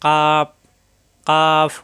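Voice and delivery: কাফ with k on the palate and a long, level aa; forward k. Shown as a demonstration. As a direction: loudly stretch the vowel of কাফ. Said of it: "একটু আগে বাড়িয়ে উপরে তালুতে". (কাফ, তালুতে লাগিয়ে টেনে)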